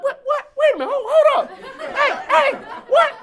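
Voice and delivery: high, loud, laughing disbelief; hammed-up shout of disbelief at being overtaken; startled outcry on the treadmill, passed by the pregnant lady; shocked yell.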